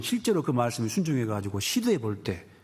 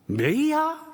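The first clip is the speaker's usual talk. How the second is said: mock a husband who can't believe it; husband's shocked cry; 14 semitones higher, much slower than usual. 남편이 놀라 소리침. (깜짝 놀란 남편의 높고 큰 외침)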